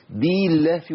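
بالله with an extra long ee after the b; added yaa. Shown as a demonstration. Teacher points out the error, long ee in بالله. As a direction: stretch بالله into bee-llah. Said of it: "في ياء زائدة".

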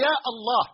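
يا الله with الله starting on a full "al"; article kept. Shown as a demonstration. Teacher showing the exception to the rule, full article in يا الله. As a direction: sound يا, then "Allah" in full. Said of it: "نحذف الألف واللام".

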